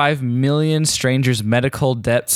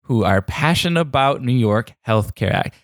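ordinary talk

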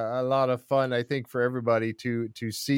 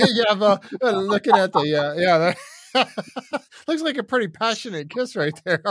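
real laughter breaking into higher, louder words; laughing while talking; 6 semitones higher, 5 dB louder than usual. Real, not acted. Host teasing guest over the passionate fish kiss, laughing. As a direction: talk through your laughter, pitched up.

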